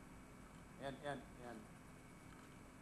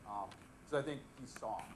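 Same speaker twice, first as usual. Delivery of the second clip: ordinary talk, nothing marked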